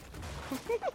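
quick high unvoiced giggle; high breathy giggle. Real, not acted. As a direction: giggle at the mess. Teammates nearly kill themselves.

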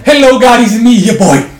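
mock prayer.

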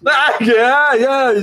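high, loud "ai, ai" interjection; exaggerated intonation. Shown as a demonstration. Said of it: "dou uma entonação que ela". (entonação exagerada, aguda e mais alta)

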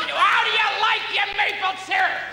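high, shouted mock-pushy host; angry shout. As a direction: yell the offer like a manic salesman. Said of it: "He's always so angry".

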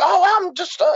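high-pitched stammered excuse; acted flustered excuse. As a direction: play a teen caught out, voice jumping up. Acts out a caught teenager's excuse.

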